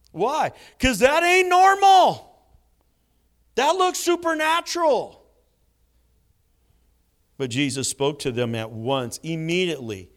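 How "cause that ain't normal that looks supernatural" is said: high, loud, spooked protest; spooked disbelief. Retold or imitated. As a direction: squeal it out as if shaken. Mimicking disciples' fright.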